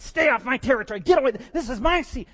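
put-on furious kid defending territory; kids fighting. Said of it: "they're all fighting".

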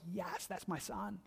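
soft, slow and proud, nearly hushed; a father quietly beaming over his son; tender. Pretend. Voicing God proudly claiming Abraham, hushed and tender. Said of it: "He's like".

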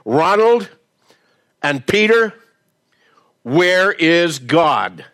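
most demanding voice. Retold or imitated. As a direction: boom it down at the boys like a towering pastor. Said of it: "with his most demanding voice".

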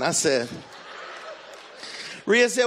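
normal talking voice

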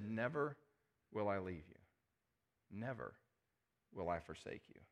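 "Never" then a pause, "will I leave you" dropped soft and low; hushed and solemn. Acted. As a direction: let each "Never" hang, then soften the rest to a near hush. Preacher repeats promise, hushed, with pauses.

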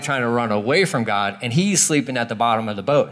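ordinary talk

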